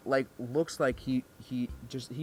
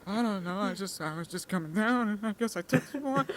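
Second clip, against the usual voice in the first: sheepish excuse; 7 semitones higher than usual. Retold or imitated. Acted student excuse to scary teacher.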